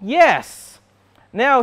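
ordinary talk

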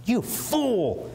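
high, scornful "You fool"; a lightly voiced sneer; mock insult. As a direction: fling the insult mockingly. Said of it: "if you call someone fool".

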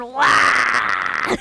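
deep, loud guttural orc bellow; orc roar. Retold or imitated. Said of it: "my orc impression".

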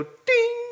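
a very high sung ding like a chime; mock phone ding. Preacher imitating Siri's ding, high mock chime. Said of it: "she'll go".

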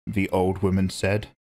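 usual speaking voice

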